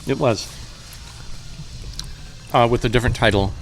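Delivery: talking while chewing; words round a mouthful of pizza; mouth full. Real, not acted. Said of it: "Excuse me, I'm chewing".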